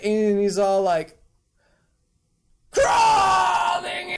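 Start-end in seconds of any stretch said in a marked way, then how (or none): 2.7-4.2 high-pitched hammed-up shout; mock anguished scream. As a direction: scream it out in fake agony. Mock outburst after baby killed.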